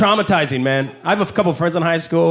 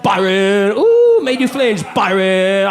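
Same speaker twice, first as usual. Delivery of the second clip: taunting schoolkid in a put-on Chinese accent; mock Chinese accent; slower than usual. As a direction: tease in an exaggerated Chinese accent. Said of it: "doing that voice".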